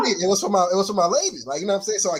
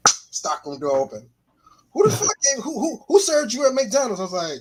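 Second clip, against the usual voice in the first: usual speaking voice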